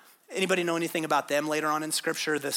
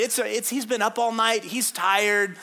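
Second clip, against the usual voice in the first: ordinary delivery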